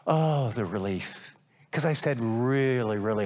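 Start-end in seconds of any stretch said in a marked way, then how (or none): 0.0-1.3 relief. Retold or imitated. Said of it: "it was like".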